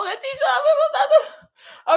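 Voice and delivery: slow, high laughter through the name; laughing. Real, not acted. Streamer laughing as she shouts out a viewer.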